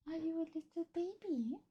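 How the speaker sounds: tiny, high, very soft coo. Real, not acted. Streamer asks her cat if it's baby, high hushed coo. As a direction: squeak it softly up high to a pet.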